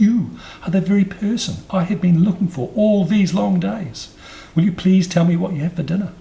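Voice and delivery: put-on high, eager, excited child voice; breathless elephant's child. A narrator's reading. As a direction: gush as a little elephant thrilled at the meeting. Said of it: "grew all breathless and panted".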